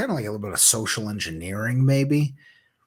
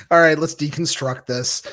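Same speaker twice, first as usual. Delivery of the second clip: normal talking voice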